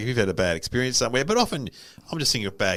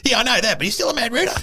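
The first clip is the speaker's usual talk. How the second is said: put-on old guy insisting; 12 semitones higher, 4 dB louder, faster than usual. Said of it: "He goes".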